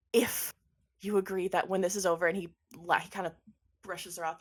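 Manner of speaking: ordinary talk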